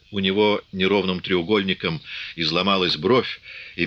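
usual speaking voice

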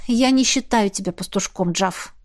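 ordinary delivery

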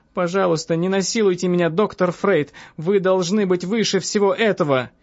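high-pitched anxious appeal; agitated woman's plea; pleading woman patient. Acted.

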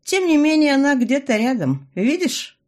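high, loud mystic reveal; fortune teller voice. A narrator's reading.

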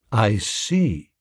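understated, thoughtful reply. A narrator's reading. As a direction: take it in thoughtfully. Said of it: "said Biffy thoughtfully".

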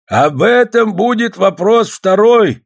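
high, drawn-out public announcement; calling for order. A narrator's reading. Golovanov speaks over shouting women, calling for order. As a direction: raise it over the uproar, slowly.

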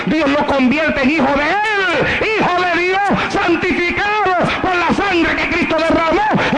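high, impassioned preacher's voice; building sermon fervor; fervent preaching. Acted. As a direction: lift the crowd toward Christ. Preaching climax ending in tears.

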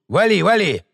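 a rough, jeering brush-off; rough thug voice. Acted. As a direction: push him out, mocking. Marauder chasing away.

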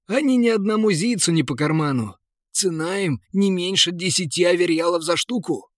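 jolly merchant. Acted.

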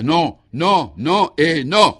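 high, loud, hammered refusals; desperate howl. A narrator's reading. Exhausted suspect refuses the commissioner, in a desperate howl. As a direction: yell each no with a last desperate effort. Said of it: "il hurla".

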